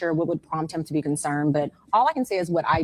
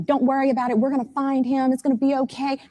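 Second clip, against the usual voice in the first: mock reassurance; 7 semitones higher than usual. Mimicking the friend's reassurance.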